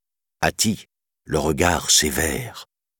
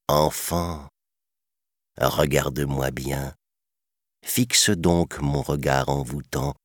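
softer, lingering hypnotic coaxing; hypnotic python; 3 dB quieter, slower than usual. A narrator's reading. Python coaxing the child to trust him, hypnotic. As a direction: draw out the last word like a spell.

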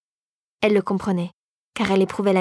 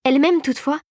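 ordinary delivery, nothing marked

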